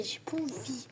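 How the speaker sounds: whispered aside under the breath; a voiceless little aside. Real, not acted. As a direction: breathe it to yourself, barely voiced. Muttered aside while playing.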